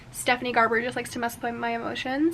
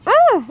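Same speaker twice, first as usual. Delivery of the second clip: sudden high loud yelp; startled yelp; 12 semitones higher, 12 dB louder than usual. Real, not acted. Reader panics as the camera battery dies, yelping.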